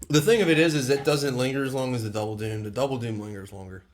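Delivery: ordinary talk, nothing marked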